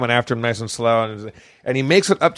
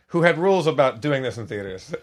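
ordinary talk, nothing marked

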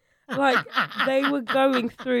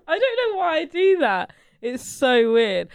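talking through giggles; words bubbling with laughter; laughing; 6 semitones higher, 6 dB louder than usual. Real, not acted. Speaker laughing while talking.

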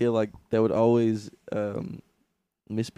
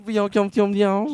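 put-on high, loud, slow Martian accent; hammed-up Martian greeting in alien accent; 9 semitones higher, 8 dB louder, slower than usual. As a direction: bend the vowels like a cartoon Martian. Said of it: "sounds like the Martian accent".

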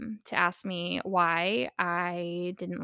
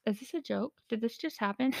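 shocked mutter; 7 dB quieter than usual. Retold or imitated. Mom stunned by her son in the theater, soft disbelief. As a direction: breathe it out in embarrassed shock. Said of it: "I was like".

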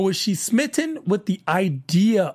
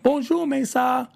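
lightly imitating a French charmer; a breezy, high French bonjour; mock French flirt saying hello; French greeting; 6 semitones higher than usual. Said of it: "comes in and is like".

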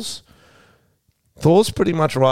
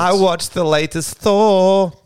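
higher, slow, announcer-style review voice; mock reviewer; 9 semitones higher, 4 dB louder, slower than usual. Host opening a movie review for viewers, mock presenter voice. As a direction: present it like a hammed-up film critic. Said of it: "Doing a little movie review, guys".

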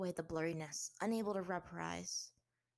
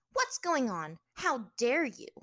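higher, louder indignant demand; 7 semitones higher, 8 dB louder, slower than usual. A narrator's reading. Chained captive to her captor, indignant. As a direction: confront them in outrage.

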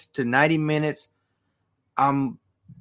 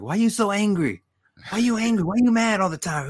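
mock taunting, higher pitched; imitating The Rock; 5 semitones higher than usual. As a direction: tease like The Rock mocking an angry rival. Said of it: "He's like".

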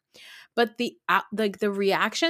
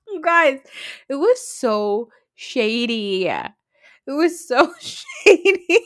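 laughing; 6 semitones higher, 6 dB louder, slower than usual. Real, not acted. Laughing at shady edit.

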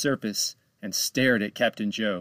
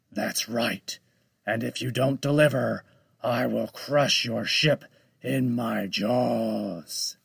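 slow, threatening sea monster; menacing shark voice; slower than usual. Acted. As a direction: drawl the threat like a giant shark.

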